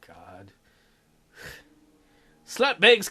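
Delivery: normal talking voice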